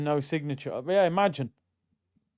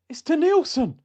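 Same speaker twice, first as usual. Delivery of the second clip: "It's Denilson" cried out high and loud in disbelief; the penny drops, blurt "It's Denilson"; shocked realisation; 10 semitones higher, 4 dB louder than usual. Retold or imitated. Fan realising his photo shows Denilson, shocked high cry. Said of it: "I looked back at the picture and I went".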